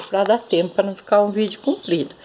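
fast, hurried wrap-up; talking fast. Real, not acted. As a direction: speed through the line to save time. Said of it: "eu vou fazer meio rápido". (falando rápido para poupar tempo)

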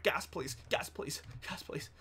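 a plea that sinks to a hush; fading plea. Pretend.

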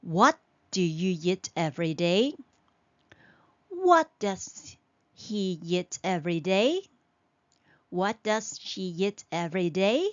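each question rises at the end on "day"; rising question tune. Shown as a demonstration. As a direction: lift "every day?" up at the end. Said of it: "your voice goes up at the end of the questions".

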